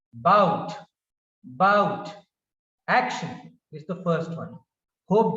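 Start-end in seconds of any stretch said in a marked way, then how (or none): 0.1-2.1 "Bout" loud after a barely heard a; stress on second syllable. Shown as a demonstration. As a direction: hit "Bout", weaken the a before it. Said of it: "Stress is given on the second syllable".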